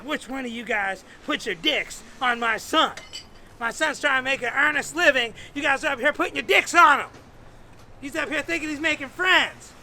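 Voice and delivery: loud, high scolding tirade; outraged single mom. Retold or imitated. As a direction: lay into the whole group as his mother. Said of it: "This kid got so mad, he called his mother".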